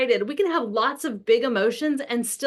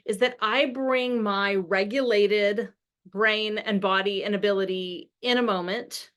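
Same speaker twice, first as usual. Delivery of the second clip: usual speaking voice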